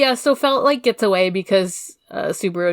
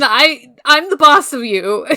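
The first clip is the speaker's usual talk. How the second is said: louder, higher mock bossy boast; bossy Subaru; 4 semitones higher, 6 dB louder than usual. Subaru bossing Felt around, mock bossy. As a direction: puff up like a bratty big brother.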